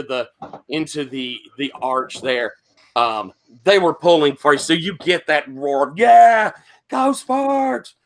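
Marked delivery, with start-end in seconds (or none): 6.0-8.0 high crowd cheer; crowd yell. Retold or imitated. GM voices the crowd roaring for Sparks, high cheer. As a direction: cheer the wrestler on from the stands. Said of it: "sort of yell".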